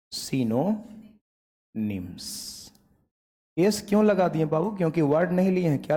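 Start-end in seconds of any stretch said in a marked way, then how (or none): 0.1-2.2 slow dictation. Shown as a demonstration. Dictating the key term.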